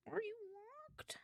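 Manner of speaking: soft, high and slow, coaxing a stubborn tablet; high pleading question. Real, not acted. Coaxing a locked iPad in a high, soft voice. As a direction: ask it sweetly, like a pet.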